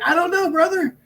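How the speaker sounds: high-pitched, defensive retort; acted-out clueless answer; helpless protest. Acts his helpless reply to wrestler.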